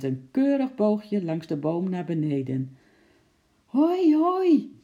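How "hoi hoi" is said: high, loud hooray; jubilant cheer. A narrator's reading. Henkie getting his arrow back, cheering. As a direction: whoop it out, drawn high. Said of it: "Juichend pakte Henkie zijn pijl".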